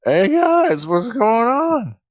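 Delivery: high, drawn-out mock-drunk slur; faked drunken pilot. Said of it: "I'll fake a drunken pilot".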